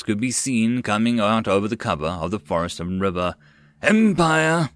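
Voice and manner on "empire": loud, higher alarmed yell. A narrator's reading. Dragon spotting enemy flags, alarmed yell. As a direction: shout the warning. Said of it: "Blue Thunder yelled".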